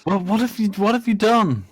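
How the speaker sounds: high, loud appalled demand; aghast what have you done; shocked outcry. Acted. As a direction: gasp it out in horror. Player shocked outburst.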